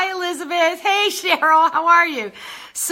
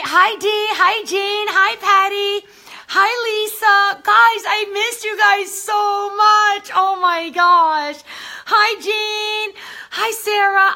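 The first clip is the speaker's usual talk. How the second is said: excited greetings; 7 semitones higher, 4 dB louder, slower than usual. Real, not acted. Greeting viewers by name.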